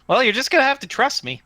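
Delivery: high-pitched little tinny voice; small tinny voice. Acted. Hidden voice in the tree asks to see faces, tinny. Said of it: "tinny voice comes from the tree".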